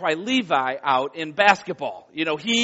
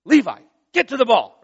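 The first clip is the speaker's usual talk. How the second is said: raised, firm call to the son; firm call, not full dad voice; 4 semitones higher, 5 dB louder than usual. Shown as a demonstration. Father calls his frozen son on court, firm raised voice. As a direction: call him over the gym noise, but hold back. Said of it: "I didn't do, like, my whole dad voice".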